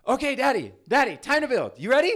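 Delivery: put-on very high, loud excited child's chant; a kid bursting to build Legos. Son voiced in story.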